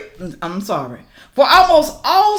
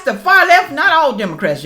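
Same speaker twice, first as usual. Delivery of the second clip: normal talking voice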